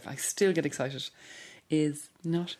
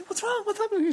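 much higher, anxious imitation of her sister; worried sister. Telling how her sister reacted at lunch, voiced as a high, anxious quote. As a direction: mimic a frantic sister's questions. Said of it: "She goes".

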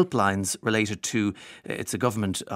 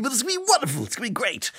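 high, breezy Boris voice; Boris impression; 12 semitones higher, much faster than usual. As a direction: mimic a blustering upbeat politician. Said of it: "Boris Johnson's national address".

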